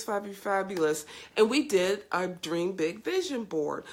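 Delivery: ordinary talk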